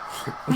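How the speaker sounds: brief soft real laugh. Real, not acted. Co-host amused by Chad's chat message, real laugh. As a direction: chuckle at a silly joke.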